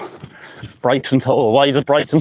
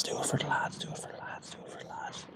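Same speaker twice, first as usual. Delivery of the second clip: whisper; 23 dB quieter than usual. Real, not acted. Self pep talk before putt.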